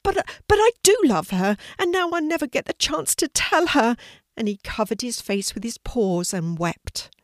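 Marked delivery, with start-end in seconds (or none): 0.0-4.0 very high, loud, distressed outburst; alarmed spluttering. A narrator's reading. Sidney fears his sister will never know, alarmed high splutter. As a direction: stammer the start, then cry out desperately. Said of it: "Sidney felt alarmed and spluttered".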